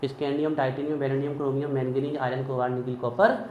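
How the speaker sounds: element names rattled off softly in one run; in a flow. Shown as a demonstration. Teacher shows students how to recite, flowing unbroken list. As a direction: recite the series without stopping, low-key and even. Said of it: "ऐसे ही flow में बोलना है".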